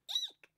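a tiny, very high mouse squeak; a high startled eek. A narrator's reading. Mouse squeaks from the wood box.

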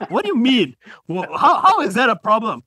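high, loud disbelieving laugh; incredulous. Retold or imitated. Friend reacting to the wife joke, laughing disbelief. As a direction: laugh out a baffled what do you mean. Listener's incredulous question acted out.

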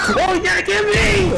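excited shout. Real, not acted. Excited yell during game.

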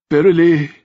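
put-on high, trembling old king, near tears; tearful old king. A narrator's reading. Old king moved to tears by praise of his son. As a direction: choke the word out as he fights tears. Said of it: "Edward's lips trembled".

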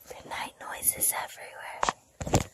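slow soft whisper; hushed storybook whisper. Acted. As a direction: whisper it slowly like a bedtime story. Parent whispers the book's line.

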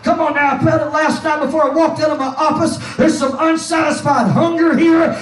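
loud, driving pulpit delivery building to a shout; full-force preaching. Acted. Preacher urging the congregation to feel it, full-force preaching. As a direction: urge the congregation on, building to a peak.

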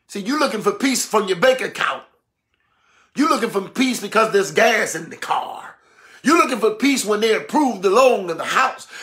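ordinary delivery

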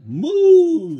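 loud, high playful moo; big mock cow moo. Cow sound read in story.

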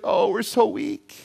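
drawn-out pretend self-pity; mock feeble whine. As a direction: imitate a sorry little weakling. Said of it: "we feel like the little weak church".

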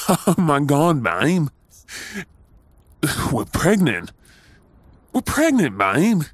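overjoyed exclamation, voice leaping up; ecstatic shout. Acted. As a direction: cry it out in sheer delight. Announcing the pregnancy with joy.

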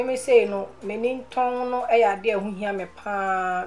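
ordinary talk

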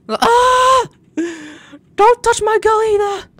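very high, loud scream of outrage; hammed-up high-pitched battle yell; protective yell. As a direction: shriek it to defend a friend. Reacting to ambush in the game.

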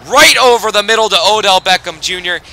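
high, loud burst as the pass connects; excited call. Real, not acted. Commentator calling a touchdown throw to his viewers, excited and loud.

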